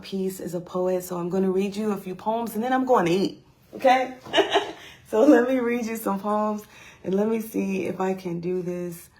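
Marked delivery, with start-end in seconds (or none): 4.2-4.9 high, airy little giggle; brief real laugh, pitched up; short laugh. Real, not acted. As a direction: break into a quick giggle. Laughs after saying she'll eat.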